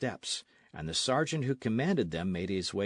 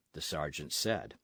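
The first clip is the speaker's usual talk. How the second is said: ordinary delivery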